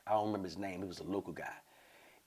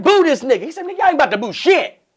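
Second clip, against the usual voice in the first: loud high back-and-forth; mock standoff; heckle shout; 14 dB louder, faster than usual. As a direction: yell it at the crowd. Heckle and comic's retort.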